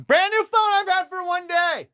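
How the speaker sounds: high-pitched, strained outburst; exasperated yell. Retold or imitated. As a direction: cry out over the lost phone. Lamenting the just-lost new phone.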